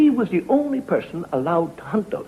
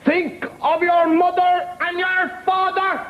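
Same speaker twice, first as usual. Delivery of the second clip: prim nun voice; 11 semitones higher than usual. Acted. Nun warns the drunk at the pub door, high and prim. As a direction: lecture him like a prim old nun.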